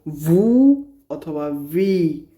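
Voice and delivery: very slow ভু and ভি, vowels stretched; alternative letter names. Shown as a demonstration. Teacher repeats both names of Italian V, drawn-out demonstration. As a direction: hold the vowels in ভু and ভি. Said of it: "অনেক সময় উচ্চারণ করা হয়".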